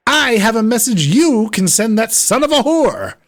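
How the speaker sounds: indignant lord rising to fury; scornful noble confronting a lackey; angry nobleman. Acted. Nobleman's threat.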